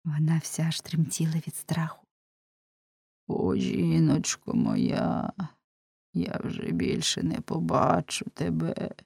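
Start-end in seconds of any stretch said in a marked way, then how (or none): 3.3-8.9 slow, drawn-out lament; slow, sorrowful farewell to a wife. A narrator's reading. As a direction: bemoan it slowly, dragging the words. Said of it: "бідкався Ламе".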